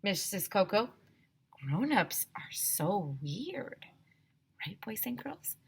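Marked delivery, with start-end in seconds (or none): 1.5-3.8 slow whispered aside. A narrator's reading. Narrator whispering an aside to the kids. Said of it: "aside whisper". 4.7-5.6 quiet aside. A narrator's reading. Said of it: "quiet aside to kids".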